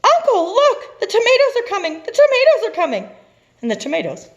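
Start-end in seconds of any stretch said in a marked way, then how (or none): 0.0-3.1 much higher, louder, fast panicked scream. Acted. Sally warning her uncle of the tomatoes, panicked scream. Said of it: "Sally screamed".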